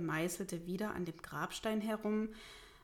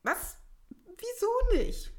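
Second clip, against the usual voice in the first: loud, high-pitched, aghast mayor; appalled; 8 dB louder, slower than usual. Acted. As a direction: burst out in shocked disbelief. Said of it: "fragte Pius entsetzt".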